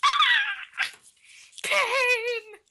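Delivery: very high, loud mock wail of pain; anguished cry. Real, not acted. Fan hurt by Leo's goodbye, loud high wail. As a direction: cry out like you've been stabbed in the heart. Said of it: "And I'm like".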